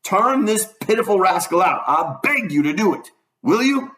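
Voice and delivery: high, pressing appeal; urgent plea. Acted. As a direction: beg her to throw him out. Doctor pleading with Mary Jane.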